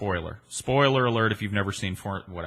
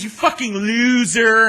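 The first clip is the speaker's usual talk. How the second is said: slow, loud, high drawn-out loser taunt; Wayne's World taunt; 12 semitones higher, 9 dB louder, slower than usual. Retold or imitated. Anna Nicole taunting him Wayne's World style, slow jeer. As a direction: stretch out loser like a playground jeer. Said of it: "She goes".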